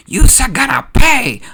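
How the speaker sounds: high, loud cartoon battle cry; vengeful yell. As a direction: yell it as a threat of revenge. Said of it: "and yells".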